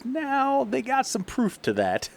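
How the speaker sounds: slow, high-pitched mock punchline; imitating comedian's joke. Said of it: "he kinda tells a joke".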